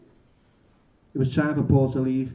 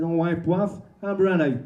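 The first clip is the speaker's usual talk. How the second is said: higher-pitched Cockney greeting; lightly put-on Cockney voice; Cockney accent; 3 semitones higher, slower than usual. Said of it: "in a Cockney accent said".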